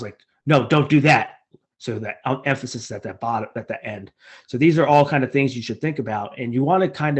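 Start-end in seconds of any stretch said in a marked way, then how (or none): 0.5-1.4 louder, sharp scolding of a misbehaving dog; harsh talking down. Shown as a demonstration. Showing test-takers the harsh tone to avoid, scolding a dog. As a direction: come down hard on the words, like scolding a pet. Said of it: "talking down as if you're talking to your dogs".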